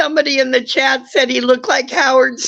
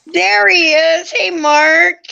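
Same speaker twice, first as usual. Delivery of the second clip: excited greeting; 8 semitones higher than usual. Real, not acted. Greeting Mark as he arrives.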